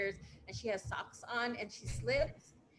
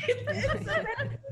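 high laugh breaking the words; 6 dB louder, much slower than usual. Real, not acted. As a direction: laugh through it at own fright.

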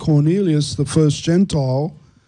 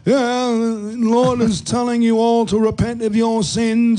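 higher, lightly hammed soapbox preaching; street preacher voice; 6 semitones higher than usual. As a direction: mimic a street corner preacher. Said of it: "preconceived idea of standing on a soapbox".

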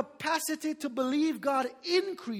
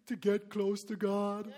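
low, slow, softer mock-pious voice; hammed-up long-suffering faster; droopy pious faster; 5 semitones lower, 6 dB quieter, slower than usual. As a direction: sag into a sorry, self-pitying drawl.

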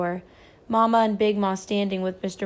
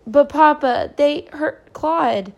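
loud, high, slow pleading through tears; tearful cry; 6 semitones higher, 7 dB louder, slower than usual. A narrator's reading. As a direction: beg Papa, on the edge of crying. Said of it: "Christopher John cried, tears streaming".

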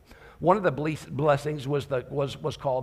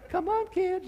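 too-gentle mom; slower than usual. Retold or imitated. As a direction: gently coax the kids as if nothing is wrong. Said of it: "talk like this when there's danger".

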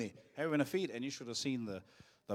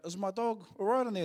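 higher, louder call across the road; yelled across road; 6 semitones higher, 4 dB louder than usual. Retold or imitated. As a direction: shout it to someone far away. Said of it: "And he yelled out".

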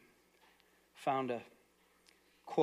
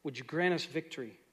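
softer, lower prayer voice; closing prayer; 4 semitones lower, 3 dB quieter than usual. Acted. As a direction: pray quietly for the church.

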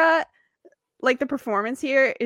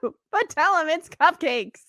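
Rebecca's plan; 7 semitones higher than usual. Retold or imitated. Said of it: "she says".

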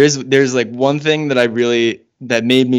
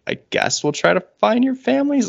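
much higher mock cop voice; squeaky, dismissive cop; 13 semitones higher than usual. Mocking the cops' response.

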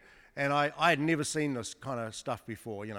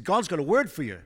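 loud, higher announcing voice; mock proclamation; 4 semitones higher, 8 dB louder than usual. Insurance guy proclaiming to Ian, loud. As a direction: declare it like a prophet. Salesman's bold pronouncement.

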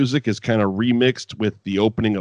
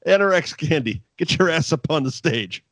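mock Bob Holly; 6 semitones higher than usual. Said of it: "He goes".

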